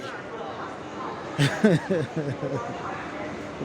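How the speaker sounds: quiet wheezing laugh without voice; a soft airy chuckle; breathy silent laugh. Real, not acted. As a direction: laugh under the breath at the memory. Laughs recalling cop's order.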